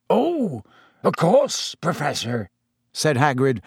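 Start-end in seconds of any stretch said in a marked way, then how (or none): 0.1-2.5 high, then sinking in relief; weak with happiness. A narrator's reading. Relieved owner answers the professor, weak with happiness. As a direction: answer faintly, overcome with joy. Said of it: "who sounded weak with happiness".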